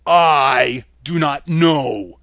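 booming reply. A narrator's reading. Oz the head answering the crying girl, loud and slow. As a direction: boom it out slowly. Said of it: "said the head".

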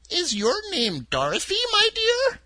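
put-on a sweet little old woman, high and gentle; old witch of the North. A narrator's reading. Witch of the North telling Dorothy her home, high and kindly.

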